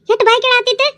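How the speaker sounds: high, separate voice asking what happened; neighbour woman. Acted. Onlooker asking the neighbours why they fight, high voiced. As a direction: pitch up as a new neighbour.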